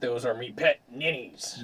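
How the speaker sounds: pirate-style sailor voice; salty sailor. Acted. Sailor introducing his pet fish, salty sea-dog voice. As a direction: answer like an old sea dog.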